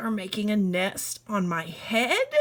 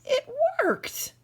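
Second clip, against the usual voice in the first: much higher, happy cry; overjoyed; 13 semitones higher than usual. Acted. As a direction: cheer it out. Piggy happy birds leave.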